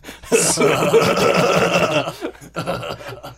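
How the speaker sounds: long voiceless mock laugh; badly imitated comedian's laugh, drawn out; Seth Rogen. Podcaster attempting the comedian's laugh for friends, long fake laugh. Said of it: "[name], that's not the laugh".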